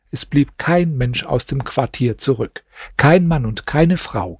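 ordinary delivery, nothing marked

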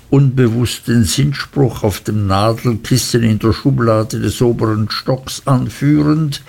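plain reading voice

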